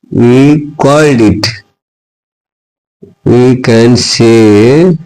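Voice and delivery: very slow, stretched words for copying; slow dictation. Shown as a demonstration. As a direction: read it out word by word for notes. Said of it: "teacher dictating the definition". (धीमे-धीमे लिखवाना)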